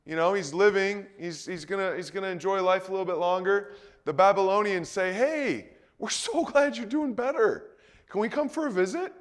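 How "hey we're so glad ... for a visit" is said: high, sugary mock-friendly voice; butter him up to get invited in; flattering envoys. Babylonian envoys flatter the recovered king, high and sugary.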